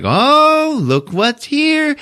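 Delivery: high, slow sing-song mom; hammed-up mother finding a surprise; imitating mom. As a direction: coo like a sweet mother. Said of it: "the mom is like".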